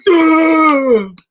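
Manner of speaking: strained high, loud choking groan; a hammed-up gasping cry of pain; mock heart-attack gasp. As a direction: fake a heart attack in one grunt. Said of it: "he looks like he's having a heart attack".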